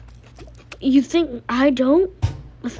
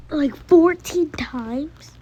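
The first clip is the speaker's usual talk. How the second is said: normal talking voice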